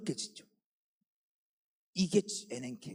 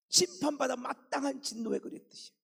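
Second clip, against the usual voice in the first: usual speaking voice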